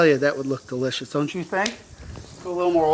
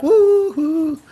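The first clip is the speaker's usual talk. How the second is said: mock woo-hoo; 10 semitones higher, 5 dB louder than usual. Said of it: "we'll be like".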